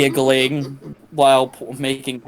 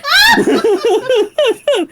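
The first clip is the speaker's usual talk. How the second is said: a very high, loud mock belly laugh; big hooting laugh; 7 dB louder than usual. Stepfather laughing his ass off, very high and loud. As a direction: cackle it up as the stepdad.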